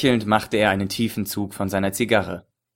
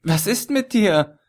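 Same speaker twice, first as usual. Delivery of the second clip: worried; 10 semitones higher, slower than usual. A narrator's reading. Chess sieht Ali zusammenbrechen.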